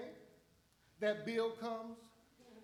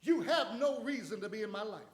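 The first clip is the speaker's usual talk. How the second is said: preaching at full force; 5 semitones higher, 5 dB louder than usual. Real, not acted. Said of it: "preaching commands to storms".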